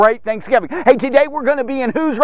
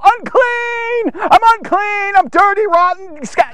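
acted high-pitched cry of an outcast; screamed warning; 9 semitones higher than usual. Leper warning everyone he is unclean, acted high scream. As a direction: call it out high and drawn, as a warning. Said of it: "would have had to scream out".

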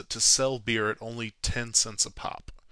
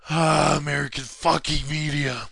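loud, higher-pitched mock rant; mock exasperation; 4 semitones higher, 6 dB louder than usual. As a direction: groan loudly at the useless press. Mock rant at contradicting articles.

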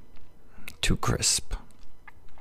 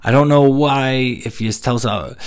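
usual speaking voice

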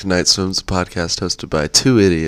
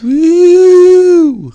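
excited cheer; 10 dB louder than usual. Real, not acted. Cheering his birthday.

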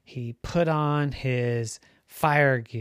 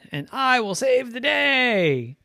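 high, loud triumphant declaration; heroic vow; 10 semitones higher, 5 dB louder than usual. A narrator's reading. Ducky promises his parents he will rescue someone, proudly proclaiming. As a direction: proclaim it like a little hero.

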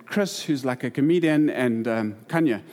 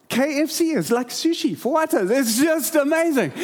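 high, excited cry of wonder; hammed-up amazement, pitched high; amazed realization; 8 semitones higher than usual. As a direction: exclaim it like a thrilling discovery. Acts out his amazed realization.

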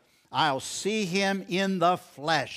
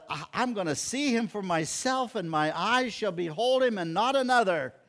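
raised pitch, eager and insistent; excited declaration; 6 semitones higher than usual. Retold or imitated. Job looking ahead to resurrection day, excitedly sure. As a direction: retell a believer's thrilled certainty. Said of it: "Job is just as excited".

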